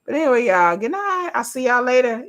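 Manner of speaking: good night lilted way up high; sing-song goodbye. Real, not acted. Streamer singing good night to her viewers, high and playful.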